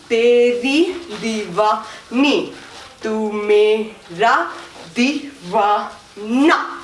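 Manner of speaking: drawn-out, syllable-by-syllable chant; slow rhythmic lyrics; slow lyric count. Shown as a demonstration. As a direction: stretch each word over a dance step.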